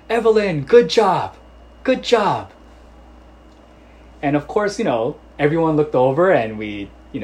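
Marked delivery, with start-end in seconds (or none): 0.1-2.5 high, loud shouting of affection from far off; a father bellowing praise at his daughter; proud dad yelling across the park. Retold or imitated. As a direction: call out proudly through cupped hands. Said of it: "screaming affection".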